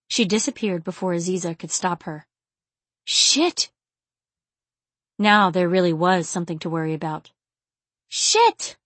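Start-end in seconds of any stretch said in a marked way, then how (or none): 3.0-3.7 high, sharp curse; alarmed heroine. A narrator's reading. Aziza realising there is something to worry about, alarmed. Narrator voices Aziza cursing. 8.1-8.8 high-pitched dismayed curse; alarmed heroine. A narrator's reading. Aziza cursing as the worry sinks in, alarmed.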